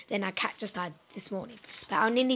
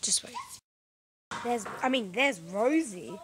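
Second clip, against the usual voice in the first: ordinary delivery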